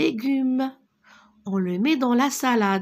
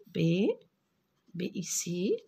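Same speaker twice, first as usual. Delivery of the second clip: whisper; 2 semitones lower, 5 dB quieter than usual. Shown as a demonstration. Teacher points out the letter.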